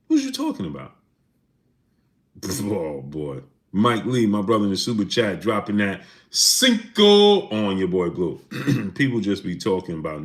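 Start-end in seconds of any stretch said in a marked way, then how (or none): 0.1-1.0 squeaky high disbelief; incredulous. Real, not acted. Streamer baffled by a chatter, squeaking in disbelief. 5.4-8.4 slow, drawn-out hype call; shoutout catchphrase. Acted. Streamer thanking a donor, slow announcer drawl.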